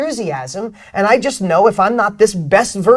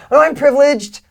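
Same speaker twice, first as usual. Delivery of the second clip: high, loud mock smugness with a laugh; mock privileged voice; 7 semitones higher, 5 dB louder than usual. Speaker mocking an imagined privileged audience member, high and loud. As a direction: ham up a smug privileged woman.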